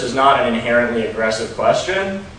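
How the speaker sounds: normal talking voice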